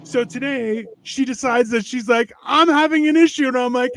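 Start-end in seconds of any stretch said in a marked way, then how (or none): 2.4-3.5 raised, higher-pitched wife quote; retold wife. Telling friends how his wife interrupted his trip, retold higher. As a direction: imitate your wife, pitched up.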